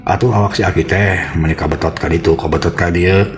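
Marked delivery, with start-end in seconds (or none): none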